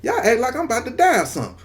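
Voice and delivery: high, fast, baffled; confused disbelief. Retold or imitated. Injured man baffled by friends apologizing, high incredulous voice. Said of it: "I'm listening like".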